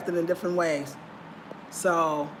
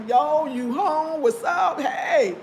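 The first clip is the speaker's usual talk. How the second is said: high, slow catcall, then louder; imitating men calling out; 8 semitones higher, 3 dB louder, much slower than usual. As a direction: holler at her like a guy on the street.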